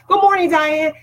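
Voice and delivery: high, happy hello to a viewer; real excited welcome; excited greeting. Real, not acted. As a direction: sing out the name in welcome. Greeting a viewer joining live.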